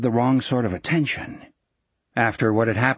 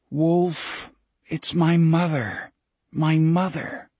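higher, pained appeal to a friend; anguished plea; 4 semitones higher, slower than usual. A narrator's reading. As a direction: plead helplessly, a little higher. Jack pleads inwardly to screaming Wolf.

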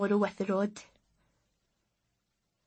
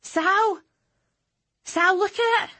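excited calling; 10 semitones higher, 4 dB louder, slower than usual. A narrator's reading. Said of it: "She went".

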